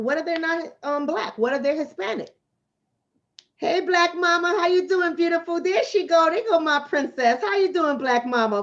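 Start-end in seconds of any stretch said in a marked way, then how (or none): 3.6-8.6 higher, fond sing-song hello; sweet greeting. Real, not acted. Mother lovingly greets her daughter on camera, high and fond. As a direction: coo over her little princess.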